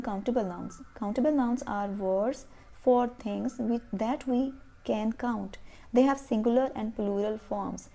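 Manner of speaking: very slow, word by word; slow textbook reading for pupils; slow reading of a rule. Shown as a demonstration. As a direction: read the rule out slowly for dictation. Teacher reading the rule aloud.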